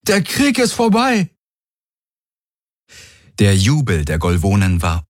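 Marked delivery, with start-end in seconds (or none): 0.1-1.3 calling out. A narrator's reading. Calls out victory.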